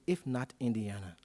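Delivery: usual speaking voice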